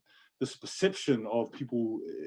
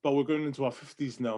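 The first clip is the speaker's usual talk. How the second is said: ordinary talk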